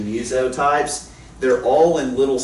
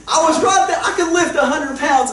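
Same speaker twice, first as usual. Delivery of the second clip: laughing protest; 13 semitones higher than usual. Real, not acted. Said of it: "laughing indignation".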